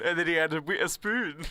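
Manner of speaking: mock Scottish "oo" in "spoon"; Scottish pub accent. Finishing the Scottish pub rhyme, mock Scottish accent. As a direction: round off "spoon" like a Scot. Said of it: "talking about how they say".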